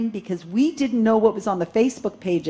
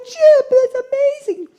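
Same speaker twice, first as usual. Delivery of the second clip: high, loud mock amazement; awed audience member; 10 dB louder than usual. Mocking the audience dazzled by flickering lights, high gushing. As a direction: gasp it out like a starstruck believer. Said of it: "the audience is like".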